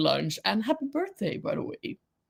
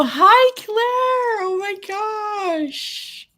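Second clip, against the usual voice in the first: much higher, louder squeal of hello; thrilled to see a friend pop in; excited greeting; 12 semitones higher, 4 dB louder, much slower than usual. Real, not acted. Greets a friend arriving in chat.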